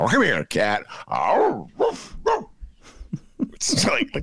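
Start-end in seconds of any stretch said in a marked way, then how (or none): 1.1-2.4 high squeaky mock woof; a mouse barking like a dog, much higher. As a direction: bark like a tiny dog. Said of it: "the mouse sounds like a dog".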